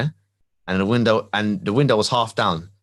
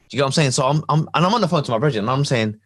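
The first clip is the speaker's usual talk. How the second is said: normal talking voice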